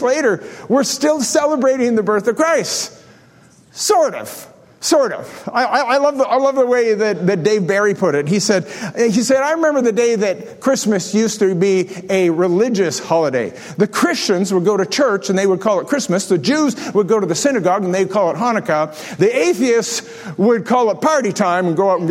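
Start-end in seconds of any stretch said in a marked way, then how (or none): none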